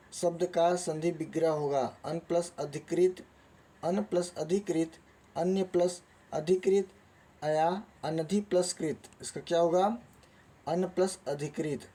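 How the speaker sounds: ordinary talk, nothing marked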